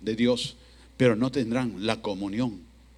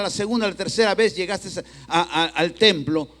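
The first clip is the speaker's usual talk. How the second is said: loud, raised-pitch preacher voicing the Lord; impassioned preaching reproach; 8 semitones higher, 7 dB louder than usual. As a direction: press the reminder home forcefully.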